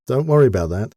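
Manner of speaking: ordinary delivery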